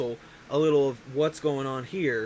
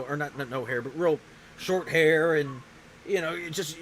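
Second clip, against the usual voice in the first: normal talking voice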